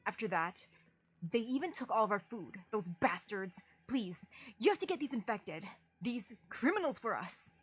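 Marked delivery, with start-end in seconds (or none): none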